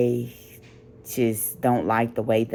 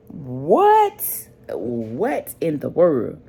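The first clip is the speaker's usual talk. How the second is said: incredulous what; much slower than usual. Real, not acted. Alone at four am, can't sleep, incredulous squeal. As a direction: squeal it in disbelief.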